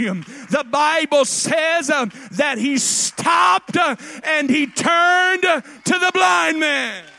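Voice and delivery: loud, high, slow shouted preaching; roaring, drawn-out pulpit climax. Acted. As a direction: hammer each phrase home.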